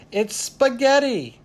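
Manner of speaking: high, drawn-out excited announcement; gleeful, slow and high; triumphant reveal. A narrator's reading. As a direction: proudly present the big answer. Ziz proudly reveals the word.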